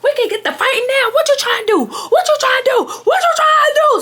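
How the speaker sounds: very high screechy mock shout; going off. Drunk friend challenging her to fight, going off. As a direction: imitate her blowing up, voice shooting up. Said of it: "she really go off like".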